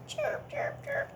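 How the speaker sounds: very high whispered chirps. Shown as a demonstration. Teacher whispering cricket sound to children. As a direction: breathe out tiny cricket chirps. It makes that.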